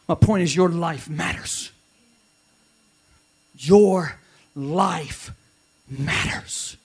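loud, slow, stressed your; emphatic answer. Acted. Pastor answering his own challenge, emphatic. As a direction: hammer it home.

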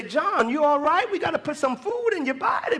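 high-pitched imitation of a fretting mother; worried mother. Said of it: "My mother would say".

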